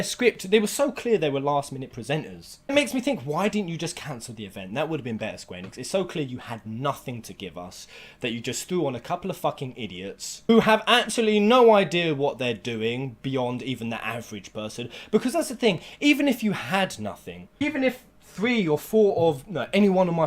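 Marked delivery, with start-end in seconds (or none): none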